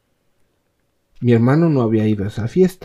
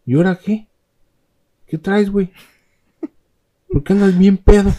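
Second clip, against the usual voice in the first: reclamo agudo y más fuerte; regaña al hermano borracho; enojado; 9 semitones higher, 5 dB louder than usual. Retold or imitated. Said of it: "bien emputado". (hermano emputado reclamando en voz alta)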